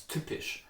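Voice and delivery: typisch with hard, aspirated T and P; hard consonants. Shown as a demonstration. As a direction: Standard German typisch, strong puff on the T. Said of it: "the hard consonants".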